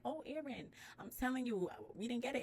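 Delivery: high, soft apologetic voice; lightly voiced sorry official; apologetic admissions lady. Quoting the admissions lady on the phone, high and apologetic.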